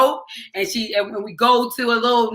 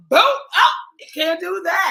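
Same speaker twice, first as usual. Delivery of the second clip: imitating her teenage self driving, cocky then startled; mock cocky shout; 6 semitones higher, slower than usual. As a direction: brag then catch yourself. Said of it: "I was all".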